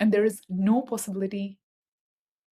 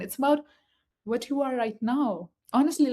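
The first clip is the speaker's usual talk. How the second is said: usual speaking voice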